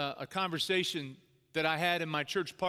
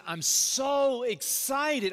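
imitating giddy excitement, high, loud and drawn out; pumped up; 8 semitones higher, 6 dB louder, much slower than usual. Board chairman sharing big news with dad, pumped up. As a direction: stretch it out, bursting with enthusiasm. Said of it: "he's just, like, pumped up".

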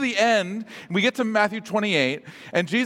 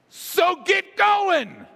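high shouted order, drawn out; rousing, high-pitched call to action; urgent command; 10 semitones higher, much slower than usual. Acted. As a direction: shout it to get people moving. Urgent retake of Jesus's command.

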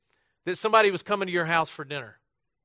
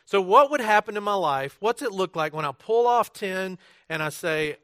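ordinary delivery

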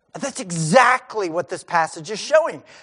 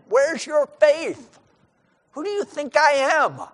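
voicing Jesus; 8 semitones higher, slower than usual. Jesus voiced rebuking his disciples.

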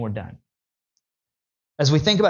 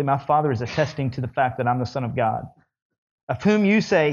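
ordinary talk, nothing marked

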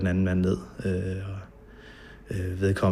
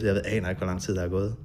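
ordinary delivery

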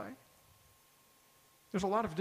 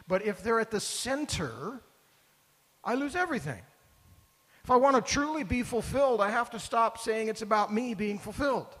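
impassioned preaching; 4 semitones higher than usual. Acted. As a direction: preach it out with full conviction. Preaching climax, rising intensity.

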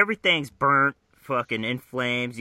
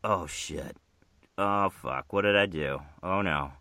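low, understated dawning horror; subdued mock dismay; 5 semitones lower, 3 dB quieter than usual. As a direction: let it sink in, voice dropping. Voices Max realizing his mistake.

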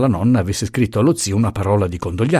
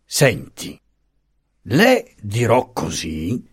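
solemn big voice; much slower than usual. A narrator's reading. Old uncle pronouncing judgment on the letter, solemn big voice. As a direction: ponder it out like a judge. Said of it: "col vocione solenne che usava per giudicare".

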